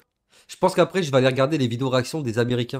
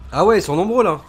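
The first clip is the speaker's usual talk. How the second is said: higher and louder, taken aback by the crowd; surprised outburst, higher and louder; startled surprise; 7 semitones higher, 8 dB louder than usual. Real, not acted. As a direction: react suddenly to the horde. Reacting to a zombie horde.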